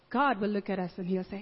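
ordinary talk, nothing marked

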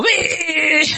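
high, drawn-out squeal of delight; childlike glee. Acted. Preacher acting God's children after a song, gleeful squeal. We go, "Wee!.

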